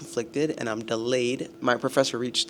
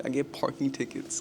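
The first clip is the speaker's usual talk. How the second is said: usual speaking voice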